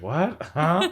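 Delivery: high, loud puzzled "What? Huh?"; not taking the hint, high and loud; mock confusion. Ice King misses the hint, a high loud puzzled "What? Huh?". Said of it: "he's like".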